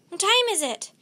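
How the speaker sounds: high, loud, insistent question; urgent shout, falling at the end; frantic asking. A narrator's reading. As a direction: demand it in a panic. Jack frantically asks again.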